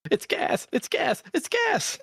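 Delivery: climbing into a very high squeak; mock squeal. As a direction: imitate it in a strained falsetto that keeps rising.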